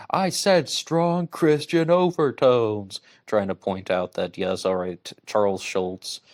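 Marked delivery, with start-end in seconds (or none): 0.1-3.0 high, loud, slow cartoon parody voice; mock cartoon voice. Quoting a Robot Chicken parody in a high, loud cartoon voice. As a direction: lampoon it like a Robot Chicken sketch. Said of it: "Robot Chicken even made a mockery of them saying".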